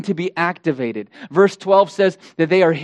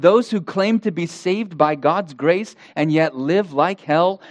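ordinary delivery

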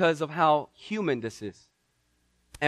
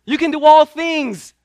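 high, loud pleading prayer; praying believers pleading loudly for Peter; fervent praying church; 10 semitones higher, 9 dB louder than usual. Acted. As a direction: beg heaven urgently, slowing on please. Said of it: "they were praying".

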